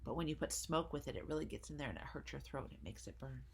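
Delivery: ordinary delivery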